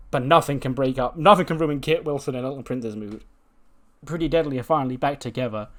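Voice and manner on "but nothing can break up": ordinary talk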